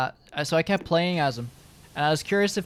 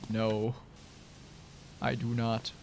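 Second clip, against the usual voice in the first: lower, softer, deadpan dwarf voice; gruff dwarf reply; 6 semitones lower, 10 dB quieter, slower than usual. Retold or imitated. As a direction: drop into a low put-on dwarf voice. Dwarf Ulfric answers deadpan.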